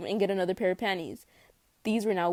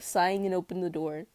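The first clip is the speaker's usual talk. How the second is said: ordinary delivery, nothing marked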